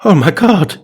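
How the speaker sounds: overplayed awe at an apparition; mock gasp. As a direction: exclaim in hammed-up shock. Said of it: "I gasped".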